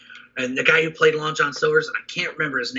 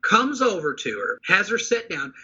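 ordinary delivery

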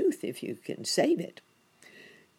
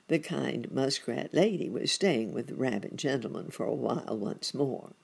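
ordinary delivery

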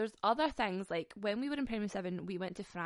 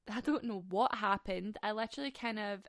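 ordinary talk

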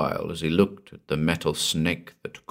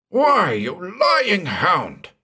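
put-on slow, much higher old man's outraged cry; furious insult; 10 semitones higher, much slower than usual. A narrator's reading. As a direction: hurl the insult at him in outrage. Bain leaps up in fury.

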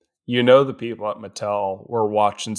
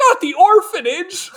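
high, loud hammed-up wail; mock anguished cry; 4 dB louder than usual. Townsfolk wailing as the orphanage falls, very high mock cry. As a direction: shriek it like a melodrama victim.

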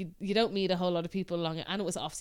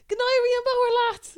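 high, loud, drawn-out farewell; Manuelito; 14 semitones higher, 7 dB louder, slower than usual. Retold or imitated. Friendly stallholder calls goodbye to the Irish walker, imitated send-off. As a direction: imitate a Spanish man calling out Irish slang. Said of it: "and he goes".

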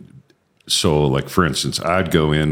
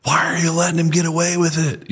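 put-on young soldier's protest; 12 semitones higher than usual.

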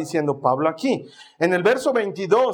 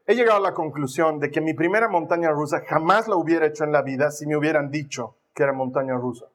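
ordinary talk

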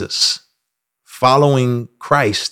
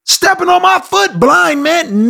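yelling at a blind man. Pretend. Scolding the blind man who stepped on your toe, mock yell. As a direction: shout him down, outraged and over the top. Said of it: "and you say".

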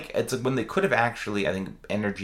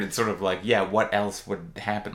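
ordinary talk

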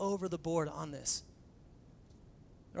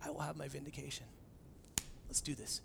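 soft reassurance; 3 semitones lower, 14 dB quieter than usual. Retold or imitated. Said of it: "he's like".

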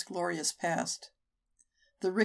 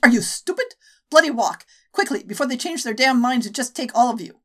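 put-on urgent, louder scolding in accented English; 4 semitones higher, 6 dB louder than usual. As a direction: hurry them off in accented English. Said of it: "She spoke in accented English".

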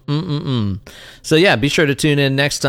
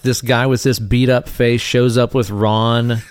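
ordinary talk

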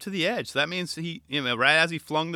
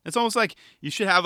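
ordinary talk

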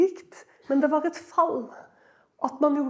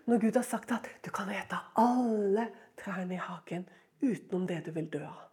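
fast, hushed and soft; urgent whisper; 7 semitones lower, 8 dB quieter than usual. Acted. Preacher whispering God's exact command to the listeners. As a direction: breathe it out quickly.

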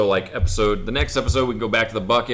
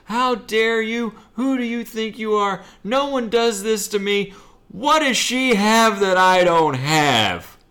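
high-pitched, drawn-out acted outrage; livid old woman; 11 semitones higher, slower than usual. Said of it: "She's livid".

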